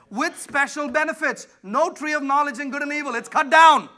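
high, loud preaching climb; preaching at full force. Acted. Preacher shouting the Easter message to the church. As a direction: lift the room toward Easter.

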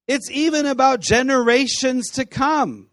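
loud and high insistence; emphatic. Real, not acted. Preacher urging students, emphatic. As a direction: drive the point home.

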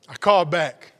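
usual speaking voice

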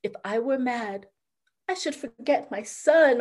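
ordinary delivery

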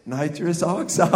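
slow, low, stretched out; dreamy craving. Acted. Pastor remembering the gas after the email, dreamy slow drawl. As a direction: savor it in a dopey drawl.

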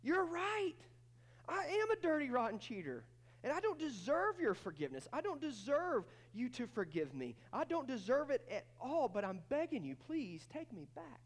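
cheating husband begging his wife, humbly; humble pleading. Acted. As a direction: beg her to take him back. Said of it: "what would he say".

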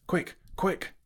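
high, breathless urgent cry; gasped. A narrator's reading. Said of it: "he gasped".

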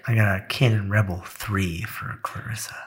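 soft unvoiced whisper; hushed aside about the gift. Real, not acted. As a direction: say it under your breath.

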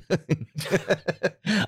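high evil witch cackle; witchy cackle. Real, not acted. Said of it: "I sound like an evil witch".

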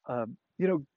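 normal talking voice

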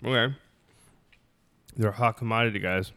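chewing a cookie while talking; mouth full. Real, not acted. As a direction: smack lips between words. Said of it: "sorry for the lip smacking".

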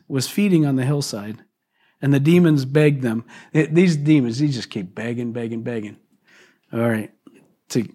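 normal talking voice

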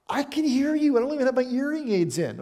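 high, dry mock retort; lightly voiced God, unimpressed; mock reply to yelling. God answers the pastors who yell, high and wry.

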